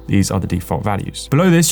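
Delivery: normal talking voice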